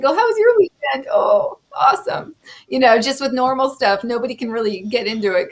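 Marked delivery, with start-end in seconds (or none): 0.1-1.1 high chirpy put-on politeness; polite small talk. Mock small talk with liberal neighbours. 1.1-2.3 slow, airy fake enthusiasm; fake cheer. Mock small talk with liberal neighbours.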